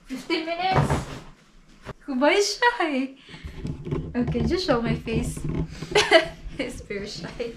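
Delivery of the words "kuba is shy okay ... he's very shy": usual speaking voice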